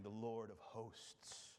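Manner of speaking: slow, hushed voice of the ocean; ocean speaking. Pretend. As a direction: murmur it low and slow like waves. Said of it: "in ocean speak".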